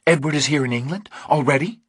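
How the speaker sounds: a touch of panic breaking through; sudden fear. A narrator's reading. Kate learning her husband is close, rushed frightened questions. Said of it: "for the first time real fear in her face".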